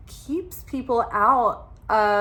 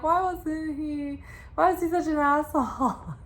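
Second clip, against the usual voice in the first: higher-pitched, mock-incredulous viewer; mock indignant question; 6 semitones higher than usual. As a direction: wail it as a mock complaint. Mimics viewers' past reaction.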